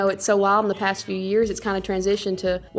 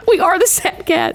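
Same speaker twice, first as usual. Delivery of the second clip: mock dramatic; 9 semitones higher, 6 dB louder than usual. Joking declaration after soap-opera remark.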